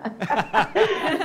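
bright burst of real laughter; laughing. Real, not acted. Venezuelan actress laughing about her Argentine friends. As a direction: laugh at the thought of it.

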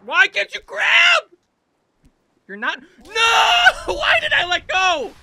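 high, loud, stretched-out wail; anguished exasperated shout. Real, not acted. Player demands why climber let go, anguished yell. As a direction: yell at the failed grab. Grab fails in game.

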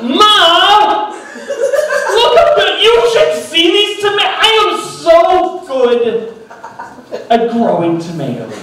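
dad shouting to his Italian mother, high and loud; dad calling his mother. Retold or imitated. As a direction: shout proudly to mom across the room. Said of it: "He called his mother".

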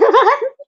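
high giggle. Real, not acted. Laughing at baby.

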